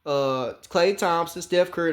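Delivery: ordinary delivery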